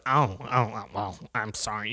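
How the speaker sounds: imitating the old woman caught on her phone, low and meek; mocking the old woman. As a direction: mumble a sheepish sorry, low and small.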